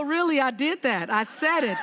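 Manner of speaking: ordinary delivery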